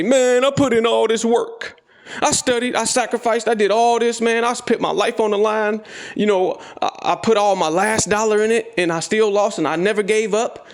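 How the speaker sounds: the inner bitch complaining that trading won't work; whiny quitter. Retold or imitated. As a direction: whine like a beaten quitter who wants to give up. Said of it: "The bitch is gonna say".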